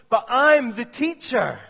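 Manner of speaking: put-on loud slow insistent child; mock child stamping her claim; child insisting loudly. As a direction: daughter insisting she is the teacher. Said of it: "And she would say".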